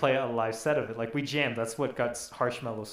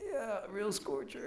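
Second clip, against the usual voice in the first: mock awkward small talk; 7 semitones higher, 10 dB quieter, slower than usual. Imitates their awkward small talk.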